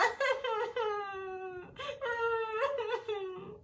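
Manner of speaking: boo-hoo like a crying bear; hammed-up sobbing of a sad bear; mock bear sobbing. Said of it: "Oso llora y balbucea".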